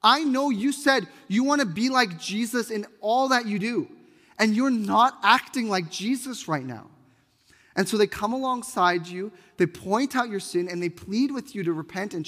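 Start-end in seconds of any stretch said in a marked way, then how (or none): 0.0-6.9 higher, earnest voice of a friend calling out sin; pleading friend. Pretend. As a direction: plead gently but firmly, higher. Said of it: "Nathan is the individual who says".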